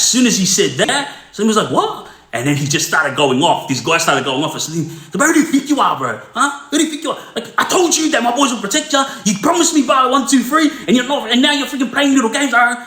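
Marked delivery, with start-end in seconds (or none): 1.7-2.1 sudden high-pitched disbelieving what; shocked what. Retold or imitated. Salim hit with a swear word, a shocked high what. 5.1-12.7 ranting going off. Retold or imitated. Inmate turning on Salim over the ten grand, a high furious shouted rant. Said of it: "And this guy was going off".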